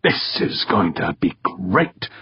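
slow, relishing inner thought; silent exultation. A narrator's reading. Director privately delighted by the race, exulting. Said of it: "Berkowitz exulted silently".

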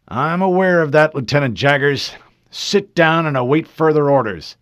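loud, stern officer; stern rebuke. Acted. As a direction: put him firmly in his place. Commander rebukes subordinate.

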